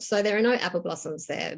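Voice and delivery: ordinary delivery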